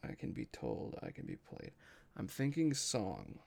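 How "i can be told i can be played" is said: fading to a whisper. Real, not acted. The GM mulls the riddle, fading to a whisper. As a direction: mutter the lines down to a whisper.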